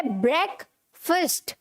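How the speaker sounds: ब्रेकफास्ट as "brek... fust", slowly; correct, broken up. Shown as a demonstration. Teacher asking what it is, ब्रेकफास्ट slowly as "brek... fust". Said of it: "क्या होता है?".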